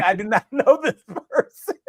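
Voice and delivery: high, giggling protest; denying he knows her, cracking up; laughing denial. Retold or imitated. Cops ask if he knows her.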